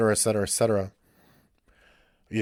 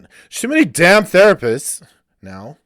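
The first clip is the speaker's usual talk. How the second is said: much higher, much louder, fired-up rant; 7 semitones higher, 11 dB louder than usual. Real, not acted. Vlogger launches his rant about therapists, loud and fired up. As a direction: let it rip, full rant mode. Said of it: "Here's my rant".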